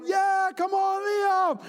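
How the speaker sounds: high excited cheer. Retold or imitated. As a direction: whoop for the kid who scored. Cheering the goal scorer.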